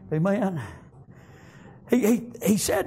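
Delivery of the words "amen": ordinary talk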